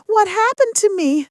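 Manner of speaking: high, loud cry of dismay; narrated anguished outcry; distressed cry. A narrator's reading. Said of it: "she cried".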